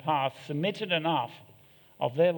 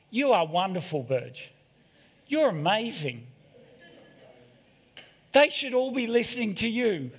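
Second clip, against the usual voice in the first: mock soothing flattery; 4 semitones higher than usual. Imagined soothing praise from pastor.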